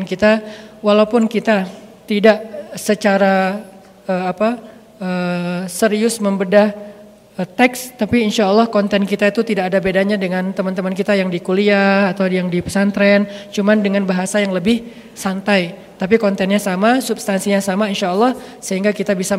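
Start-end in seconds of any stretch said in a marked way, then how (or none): none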